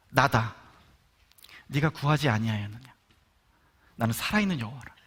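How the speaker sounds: low, resonant voice of the Lord; voice of God. Retold or imitated. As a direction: speak as God answering from above. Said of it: "뭐가 이렇게 울리는 것 같은데".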